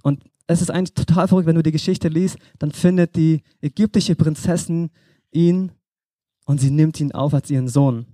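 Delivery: usual speaking voice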